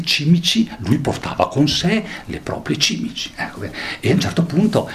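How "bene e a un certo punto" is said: ordinary talk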